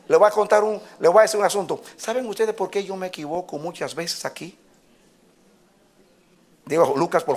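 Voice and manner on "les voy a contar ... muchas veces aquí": ordinary delivery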